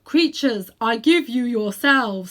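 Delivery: loud, slow, majestic lion's voice; strong, happy voice. A narrator's reading. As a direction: proclaim it grandly like a king. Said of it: "said the strong, happy voice of Aslan".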